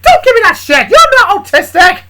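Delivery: high, furious shout; angry snapping. Acted. Big snapping at Charlie in disbelief, angry shout. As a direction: explode at him, loud and high. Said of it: "snapped at Char".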